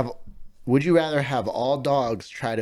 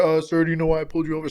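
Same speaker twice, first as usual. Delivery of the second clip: fast cop voice; 4 semitones higher, 6 dB louder, much faster than usual. Pretend. Imitating a traffic cop.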